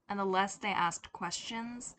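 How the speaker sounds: plain reading voice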